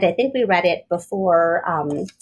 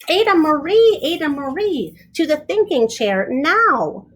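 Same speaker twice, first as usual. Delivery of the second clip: stern dad; 6 semitones higher than usual. Acted. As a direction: scold her straight to the thinking chair. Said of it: "said her dad".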